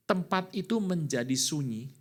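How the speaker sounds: ordinary delivery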